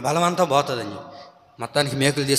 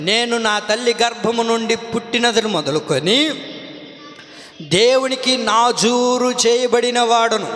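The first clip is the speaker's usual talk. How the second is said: scripture reading; 7 semitones higher, slower than usual. Acted. Reads scripture aloud.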